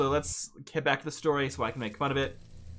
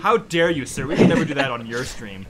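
mock outrage; 11 dB louder than usual. Mock offense at viewer.

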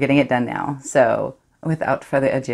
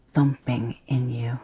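whisper; slower than usual. Acted. Secret thrill in monologue.

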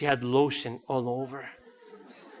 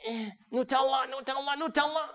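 put-on high, soft little-kid voice; imitating eager child; 8 semitones higher, 5 dB quieter than usual. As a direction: reach and strain for it like a small child. Child reaching for Nutella in store.

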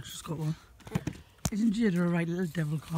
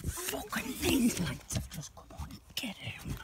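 slow alarmed whisper; panicked whisper; 5 semitones higher, 3 dB quieter, slower than usual. Real, not acted. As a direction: whisper that they've been spotted. Neighbour's lights come on.

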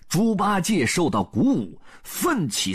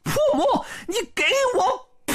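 very high attacking yell; battle cry; much faster than usual. A narrator's reading. Sun Wukong swings his cudgel, high battle cry. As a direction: drive each word to a piercing final yell. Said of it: "大叫一声".

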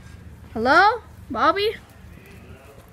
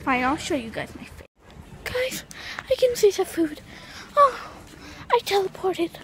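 usual speaking voice

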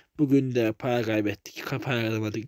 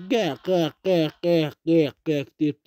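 duck call imitation; 2 semitones higher, slower than usual. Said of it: "Bizim bu ördekler vardır abi".

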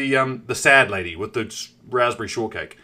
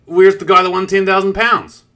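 louder, outraged; indignant demand; 4 semitones higher, 8 dB louder than usual. Retold or imitated. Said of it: "I was like".

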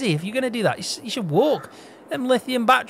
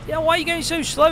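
teasing call; 10 semitones higher than usual. Real, not acted. Calling out to slow scooter driver.

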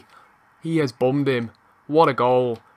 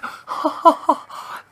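high, breath-driven burst of laughter; delighted laugh; 12 semitones higher than usual. Real, not acted. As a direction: laugh out in disbelief at a goal. Delight at wonder goal.

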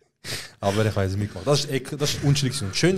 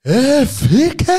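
high, loud, slow drawn-out call; mock yelled insult; 13 semitones higher, 8 dB louder, much slower than usual. Stranger calling out rudely to him in the street. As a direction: hurl it across the street.

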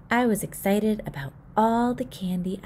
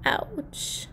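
whispered ouch; 8 semitones higher, 5 dB quieter than usual. Acted. Hand hurts on clothesline.

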